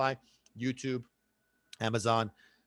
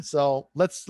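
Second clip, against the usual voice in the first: normal talking voice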